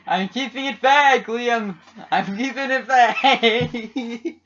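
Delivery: high, loud exasperated yell; shouting. Pretend. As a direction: yell back, drawn out. Shouted reply to the chant.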